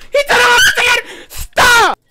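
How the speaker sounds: high-pitched panicked shriek; terrified scream. Real, not acted. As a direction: scream it in alarm. Screaming at repeated scare.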